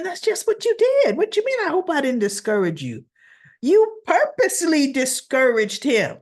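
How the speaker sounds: high, sharp indignant retort, pitched well up. Retold or imitated. Talking back at Anna on TV, indignant and mocking. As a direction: scoff at her fake concern.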